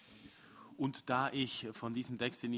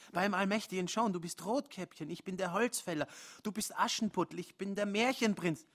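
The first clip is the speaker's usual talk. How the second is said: high, louder fairy-tale comparisons; heated insistence; 7 semitones higher, 4 dB louder than usual. Acted. Man lists fairy-tale roles at Joan, high and insistent. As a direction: hammer each role home. Narrator voices heated argument.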